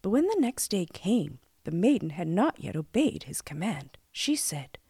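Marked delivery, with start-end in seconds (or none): none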